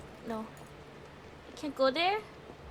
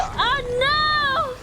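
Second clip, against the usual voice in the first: high, drawn-out cry of dismay; alarmed cry; 8 semitones higher than usual. Real, not acted. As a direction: wail it in sudden alarm. Calling out in game alarm.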